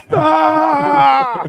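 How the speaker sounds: high, loud sustained "ah" scream; yelling guy. Retold or imitated. Friend imitating that guy that yells, long yell. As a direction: mimic the yeller with one long shout. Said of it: "Like that guy that yells".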